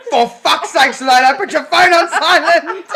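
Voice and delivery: loud, high mock complaint; playing an irritated partner, laughter breaking in; retold exasperated partner. Woken partner complaining about the phone, hammed-up exasperation. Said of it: "And he goes".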